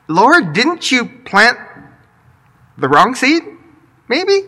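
sweetly accusing, rising pitch; nice on top, dagger underneath; passive-aggressive question. Pretend.

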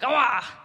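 mock triumphant ah. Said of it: "she's like".

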